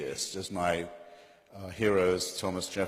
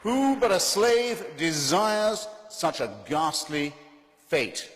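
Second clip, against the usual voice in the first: loud, high, drawn-out rhetorical questions; impassioned outcry; 10 semitones higher, 6 dB louder, slower than usual. Real, not acted. Debater challenging the audience on wanting this, impassioned outcry. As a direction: hurl the questions out, slowly and high.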